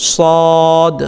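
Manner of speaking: সদ with its vowel held one alif; drawn-out letter name. Shown as a demonstration. Teacher repeating after the stretch rule, long সদ. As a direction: sustain the middle of সদ evenly. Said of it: "টান দেবেন".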